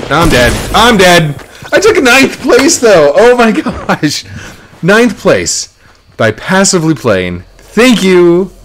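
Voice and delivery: high, loud laughing exclaim; excited outburst. Real, not acted. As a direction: burst out in giddy disbelief. Died, ninth place, laughing.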